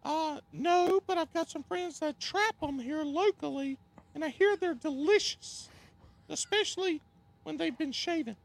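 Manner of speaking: high strained falsetto voice; cracking high pretend voice. Still voicing Paige.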